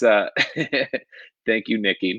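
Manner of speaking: ordinary talk, nothing marked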